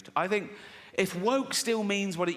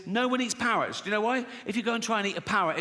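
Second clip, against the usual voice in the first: ordinary talk, nothing marked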